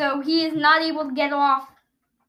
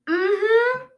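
muffled through tape; 6 semitones higher than usual. Real, not acted. Mouth taped, humming.

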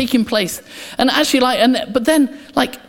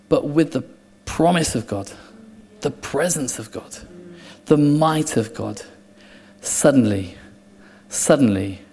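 hushed preaching; 9 semitones lower, 6 dB quieter than usual. Acted. Quiet build to the charge.

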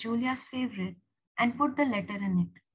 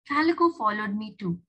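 ordinary delivery

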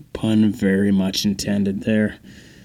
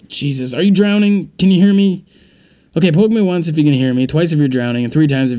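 alarmed calling out; 5 semitones higher, 4 dB louder than usual. Acted. Partner drowning in tub.

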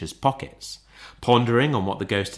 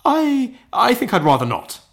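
high, hesitant stammer; nervous reluctance; 6 dB louder than usual. Acted. Scrooge refusing the three spirits, high and nervous. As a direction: start high, stumble, then politely back out. Dismayed Scrooge declines the haunting.

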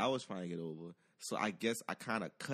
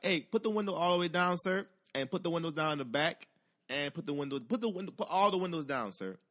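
officer's orders; 7 semitones higher, 4 dB louder than usual. Retold or imitated. Acts out trooper ordering windows down.

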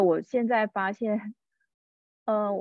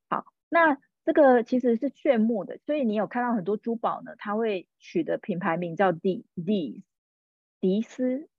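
normal talking voice

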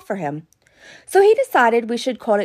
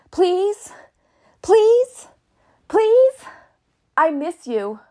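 rising pleas, higher and louder each time; mock desperate begging; 7 semitones higher, 3 dB louder, much slower than usual. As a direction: wail the pleases like a hammed-up soap heroine. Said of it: "something like this".

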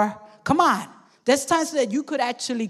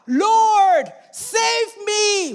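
crying out; 8 semitones higher, much slower than usual. Retold or imitated. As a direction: shout it like a drowning man. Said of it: "He cried out".

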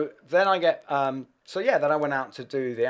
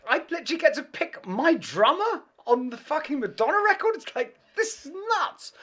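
high, incredulous rush, much higher; giddy disbelief; 11 semitones higher than usual. Retold or imitated. Choosing his own drummer, high giddy disbelief. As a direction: sound like you can't believe your luck.

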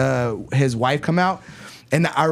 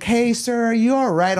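put-on higher voice of a concerned older lady; pitched-up lady imitation; imitated Karen lady; 7 semitones higher than usual. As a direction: lightly mimic a Karen checking in. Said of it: "old Karen lady in the van".

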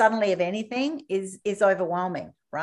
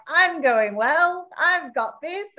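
cocky; 8 semitones higher, slower than usual. Pretend. Footballer sure he has the ball.

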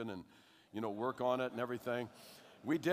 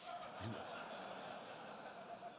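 breathy laugh; 5 semitones lower, 15 dB quieter than usual. Real, not acted. Laughs at own punchline.

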